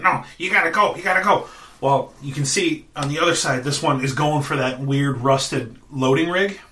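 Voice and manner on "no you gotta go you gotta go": urgent goblin. Acted. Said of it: "He's like".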